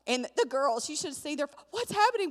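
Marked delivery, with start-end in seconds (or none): 1.7-2.3 put-on high, excited child voice; alarmed kids. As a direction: imitate startled little girls. Voices the alarmed girls in car.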